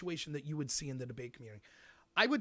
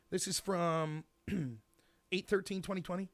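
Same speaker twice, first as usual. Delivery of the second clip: ordinary delivery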